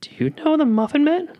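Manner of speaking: very high squeaky cartoon voice; Shrek movie quote voice. Friend quoting the Shrek scene in a squeaky high voice. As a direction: pipe it up like a tiny cookie. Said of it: "Did that sound like Gingy".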